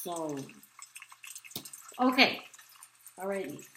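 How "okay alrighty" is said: normal talking voice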